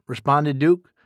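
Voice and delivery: ordinary delivery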